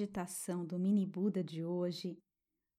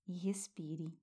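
whispered breathing cue; whispering; 5 dB quieter than usual. Shown as a demonstration. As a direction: let it fall to a whisper. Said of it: "Sinta essa transformação".

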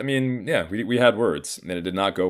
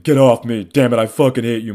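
loud, raised-pitch protest, no real sobs; retold upset outburst; 4 semitones higher, 9 dB louder than usual. Sobbing son pushing his mom away, retold loud outburst. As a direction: reenact lashing out, much louder. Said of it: "So I'm sobbing".

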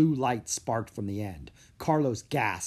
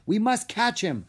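urgent cry; 9 semitones higher, 4 dB louder than usual. Acted. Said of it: "Diego cried".

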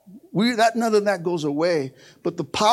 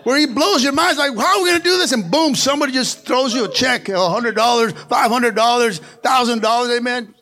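loud, high, exultant preaching; full-force preaching; 6 semitones higher, 5 dB louder than usual. Acted. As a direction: shout the good news to the church. Preacher shouts God's provision.

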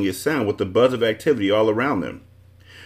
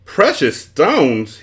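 slow, loud roar of the words; a magician roaring back in disbelief; 6 semitones higher, 6 dB louder, much slower than usual. A narrator's reading. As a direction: roar it out, drawing it out. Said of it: "he roared".

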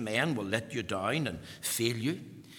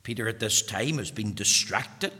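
usual speaking voice

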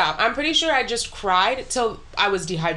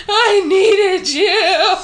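loud, high, drawn-out mock wail; melodramatic sobbing; 8 semitones higher, 7 dB louder, much slower than usual. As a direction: wail it slowly, hammed-up and half laughing. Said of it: "so fucking dramatic and pathetic".

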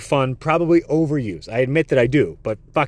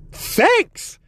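much higher, squeaky little thanks; bashful thanks. Retold or imitated. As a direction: answer like a flattered kid. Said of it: "I was like".

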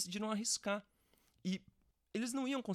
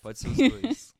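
loud echo; 8 semitones higher, 14 dB louder, slower than usual. Real, not acted. Echoes the line loudly.

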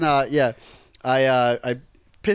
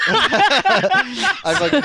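laughing; 12 semitones higher, much slower than usual. Real, not acted. Laughing while talking.

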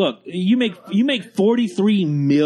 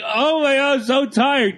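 higher, mock-whining teenager; whiny kid; 6 semitones higher than usual. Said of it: "It's like telling my twelve-year-old".

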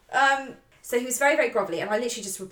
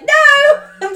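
mock panic shout; 15 dB louder than usual. Retold panic on seeing his call.

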